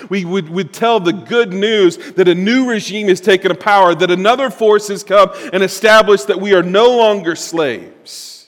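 preacher proclaiming a new kingdom; fervent preaching. Real, not acted. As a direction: announce the good news boldly. Preaching build.

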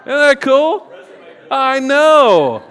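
high, loud, thrilled exclamation; excited. Real, not acted. Pastor bragging playfully to the congregation, excited. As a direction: burst out delighted.

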